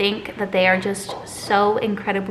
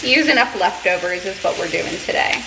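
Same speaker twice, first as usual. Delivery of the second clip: ordinary delivery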